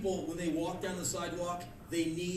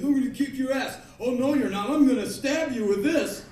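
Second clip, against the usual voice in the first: loud, raised-pitch confrontation; heated threats; 7 semitones higher, 8 dB louder than usual. Acted. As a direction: square up and threaten loudly. Two men squaring up, acted.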